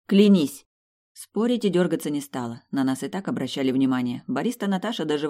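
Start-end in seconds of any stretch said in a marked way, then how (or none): 0.1-0.6 sharp, loud demand; demanding exclamation. Acted. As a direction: snap it out as an order. Heroine demands an oath.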